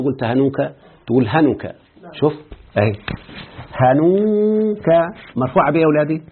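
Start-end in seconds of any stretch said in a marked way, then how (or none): 3.7-4.9 drawn out. Shown as a demonstration. Teacher drawing out the word.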